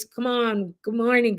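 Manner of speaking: normal talking voice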